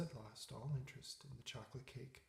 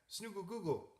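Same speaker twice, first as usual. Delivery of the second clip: higher, silly voiced snore; snoring dog; 6 semitones higher than usual. A narrator's reading. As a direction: snuffle it like a dozing dog. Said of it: "The dog and the pig began to snore".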